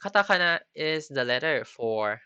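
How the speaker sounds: usual speaking voice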